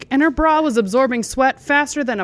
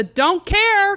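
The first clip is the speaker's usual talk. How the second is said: called out; 10 semitones higher than usual. Acted. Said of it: "called out Kyle".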